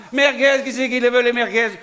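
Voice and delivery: voix haute et forte, qui interpelle; appel de marchand. Acted. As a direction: crie les merguez à la cantonade. Vendeur qui appelle la foule. (le vendeur de merguez appelle les clients à voix haute)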